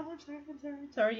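soft, mumbled string of apologies; small, hushed, repeated apology; nervous apologizing. Retold or imitated. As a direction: imitate your own nervous habit under the breath. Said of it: "that's just the nervous part".

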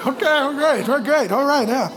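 high, loud, strained placating; mock-overwhelmed dad giving in; flustered placating. Dad taking blows from his kid, flustered placating.

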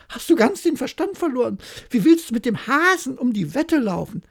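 high, loud horrified shriek; shrieking wife. Acted. Wife shrieking at her husband's mad bet, high and loud. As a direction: cry out at him in disbelief. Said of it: "begann die Frau loszuschreien".

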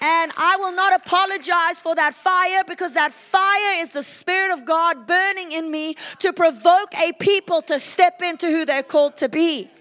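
loud, driving preacher's proclamation; full-force preaching. Real, not acted.

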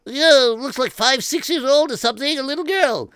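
high excited soldier. Acted. Soldier describing the little girl to the king, high voice. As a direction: tell the king eagerly, voice up high.

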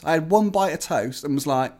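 ordinary talk, nothing marked

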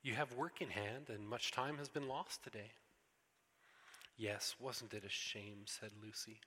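storybook reading with voiced characters; story reading. A narrator's reading. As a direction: read it aloud like a bedtime story for the congregation. Pastor reads Narnia story aloud.

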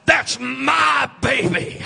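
slow, loud, drawn-out claim; mother claiming her child. Retold or imitated. Preacher voicing a mother in the nursery, slow loud claim. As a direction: hold each word out like a proud mother. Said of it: "But she says".

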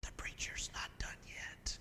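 soft unvoiced hush, leaning in close; gentle whisper. Usher gently whispering to a departing worshipper. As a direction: whisper it kindly so no one else hears. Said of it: "very gently says".

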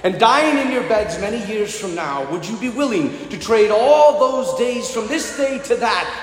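Wallace's Scottish battle speech, building to a shout; Scottish accent. Retold or imitated. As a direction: rouse the troops in a Scottish accent. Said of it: "use my best Scottish accent".

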